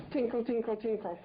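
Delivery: high sing-song tinkle like a shop bell; mock doorbell tinkle, pitched high. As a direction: chime it out like the bell over a shop door.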